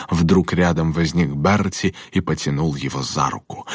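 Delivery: usual speaking voice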